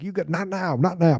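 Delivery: half-hearted imitation of a boss yelling; mock yell. De Niro yelling at DiCaprio at the party, mock yell. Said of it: "maybe he yells at De Niro".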